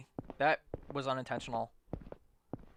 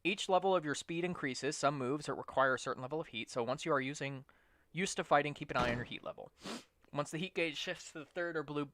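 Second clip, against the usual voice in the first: tutorial read. Acted. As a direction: read the tutorial text flatly. Reads game tutorial text.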